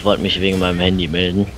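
deep disguised voice. Pretend. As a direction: drop the voice way down to sound older. Prankanruf mit verstellter Stimme. (tiefe verstellte Stimme)

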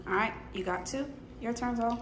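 usual speaking voice

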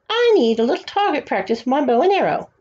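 lightly voiced bratty boy; bratty young duke taunting Bartholomew; spoiled duke. A narrator's reading. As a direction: taunt him with a sneer. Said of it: "Grand Duke Willifred".

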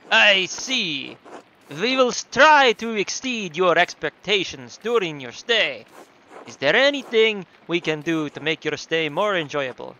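put-on loud, slow, accented innkeeper; big drawn-out host voice; Nico from GTA IV. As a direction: present the house in a hammed-up foreign accent. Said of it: "do Nico from G T A four".

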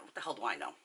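low, soft throwaway question; understated shrug of a question; dismissive aside. Retold or imitated. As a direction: toss it off quietly, pitch dropping. Dismissive aside after retold order.

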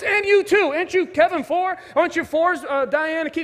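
light up at a familiar face; excited recognition. Retold or imitated. Pastor recognizing Kevin's family, high and delighted.

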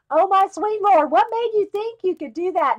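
put-on camp it up in a Southern accent like a scandalized lady. Friend Sandy scolds a first drawing, mock Southern accent, high and loud. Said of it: "I just gave her a Southern accent".

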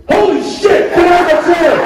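high excited squeal of recognition; starstruck owner. Retold or imitated. Tattoo shop owner recognizing actors, high excited squeal. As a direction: shriek it like a starstruck fan.

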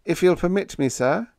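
high, polite apology; lifted, deferential request; apologetic. A narrator's reading. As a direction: ask leave deferentially. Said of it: "Biggs said apologetically".

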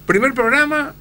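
high, loud emphatic start; excited emphasis. Real, not acted. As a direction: burst out with it. Dramatic storytelling.

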